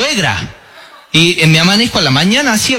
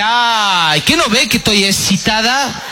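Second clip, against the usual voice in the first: coy wife; 6 semitones higher, slower than usual. Retold or imitated. Wife in the tanga, story quote.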